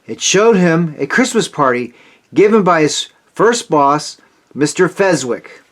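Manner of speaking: ordinary delivery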